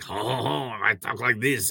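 a lazy French Canadian accent, played for laughs; bad French Canadian accent. Showing his bit on the radio, bad French Canadian accent. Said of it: "just doing a bad French, uh, Canadian accent".

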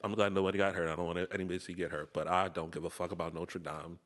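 ordinary talk

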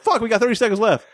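high-pitched urgent retold argument; mock arguing. Two men arguing over a bomb countdown, mock urgency. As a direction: act out a frantic countdown squabble. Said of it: "they're still arguing".

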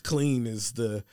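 ordinary delivery

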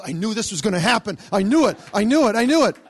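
loud, mounting self-reproach; exasperated outburst. Retold or imitated. Rejected visitor walking down the hall, loud exasperated outburst.

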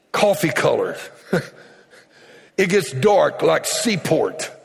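usual speaking voice

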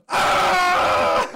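loud, high, startled yelp of alarm; a cartoonish shriek before bolting; panicked scream. Juggler spotted by the crowd, shrieking in mock panic before running. Crowd spots him, he flees.

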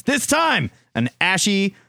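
high, drawn-out buildup to the name; hammed-up show host intro; hype announcer. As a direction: announce the contestant with mock grandeur. Hyped introduction of the letter writer.

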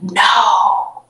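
long, restrained mock yell; retold yell. Patient still refusing the light, drawn-out retold yell. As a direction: draw out the no in protest. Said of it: "And I still yelled".

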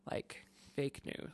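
whispered aside. Real, not acted. Quiet joking aside.

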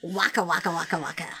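lightly voiced Fozzie Bear, softening to the end; a hint of Fozzie's waka waka; Fozzie catchphrase. Host quoting Fozzie Bear's catchphrase to cohost, light imitation. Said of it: "Fozzie's character".

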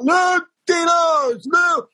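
put-on long, high drunken holler; obnoxious drunk fan. Planted drunk fan greets Lou backstage, obnoxious holler. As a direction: holler the name like a wasted superfan. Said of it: "be the most obnoxious, drunk fan".

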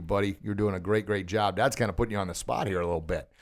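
usual speaking voice

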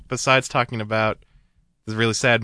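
ordinary talk, nothing marked

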